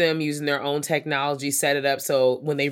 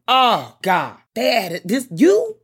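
dismayed surprise; 8 semitones higher, 7 dB louder, slower than usual. Real, not acted. Dismay at colonizer appearing.

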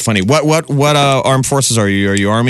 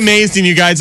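ordinary delivery

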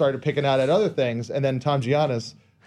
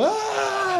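very high, put-on shriek of alarm; hammed-up scream, much higher; mock panicked scream. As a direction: shriek like a mother in danger. Mother knocks over bookshelf.

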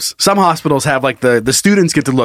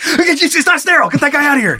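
outraged staff; 10 semitones higher than usual. Pretend. Outraged at streaker.

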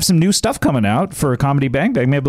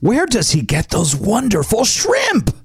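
high, slow, wondering Joker line; 7 semitones higher, slower than usual. Acted. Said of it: "Quote number five".

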